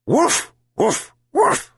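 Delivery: very high, yelping woofs; yelping bark. Acted. Dog yelps to show the fallen pill, high barks. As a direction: bark sharply like a small dog. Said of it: "the dog yelped".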